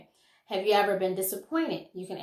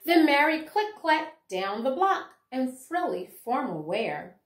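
lively, sing-song picture-book reading; reading to children; 4 semitones higher, 5 dB louder, slower than usual. Acted. Teacher reading a rhyming picture book to her class, lively storytelling. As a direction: bring the rhymes alive for little listeners.

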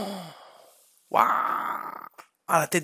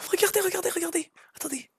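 whispering; 8 semitones higher, 12 dB quieter, faster than usual. Real, not acted. Hushes while showing the cat.

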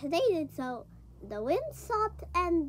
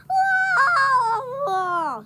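whoa; 7 semitones higher, 8 dB louder than usual. Acted. Peter sinking into water.

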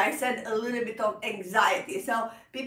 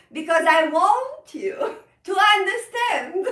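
stretched out, higher and louder, lingering on "want"; rubato phrasing; 7 semitones higher, 5 dB louder than usual. Shown as a demonstration. Teacher showing students rubato in her own speech, slowed and swelling. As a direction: lean on "want" and let the time pull back. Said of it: "I am doing a feeling of rubato".

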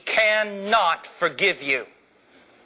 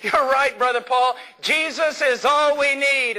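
put-on lightly raised, eager young man's praise; excited young man; 6 semitones higher than usual. Young man after the sermon thanking Brother Paul, excited. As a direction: imitate a thrilled young listener gushing. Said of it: "he was all excited".

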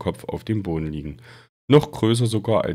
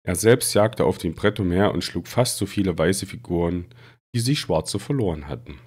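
plain reading voice

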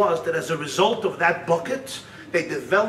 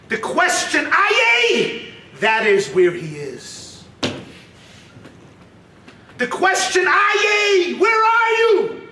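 high, ringing cry of the question; impassioned preaching; 10 semitones higher, 4 dB louder, slower than usual. Acted. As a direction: call it out high like a fired-up preacher.

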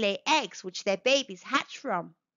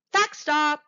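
higher and louder announcement; excited callout; 4 semitones higher, 5 dB louder than usual. Acted. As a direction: call it out like a fun game signal. Announcing a fact break heading.

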